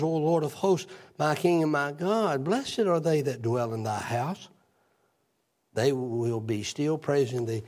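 a measured, reverent reading of the psalm; scripture reading. Real, not acted. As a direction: read the verses steadily like scripture. Reads Psalm 84 aloud.

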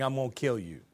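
low, hushed menace; quiet threat. Retold or imitated. Jezebel's nasty note to Elijah, read as a quiet threat. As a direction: drop low and soft for the threat. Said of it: "writes him a nasty note saying".

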